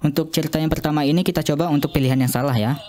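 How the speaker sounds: ordinary talk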